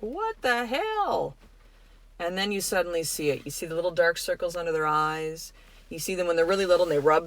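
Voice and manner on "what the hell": drawn-out, higher, baffled what-the-hell; exasperated. Retold or imitated. Parent watching a child's tantrum, slow exasperated disbelief. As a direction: stretch it out in disbelief at the kid. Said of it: "and you're like".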